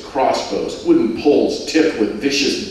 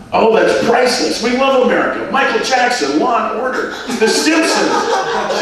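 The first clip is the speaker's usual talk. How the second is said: much higher, louder Liverpool woman's voice; gushing tourist from Liverpool; Rose; 13 semitones higher, 3 dB louder than usual. Acted. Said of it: "she said out the side".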